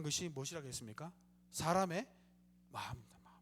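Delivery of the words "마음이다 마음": whisper. Real, not acted. Preacher drops to whisper.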